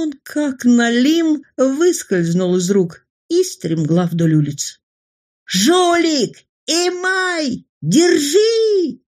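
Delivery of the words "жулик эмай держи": higher yell after a runaway thief; chase yell. Acted. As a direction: shout after him to stop him. Policeman yells after fleeing boy.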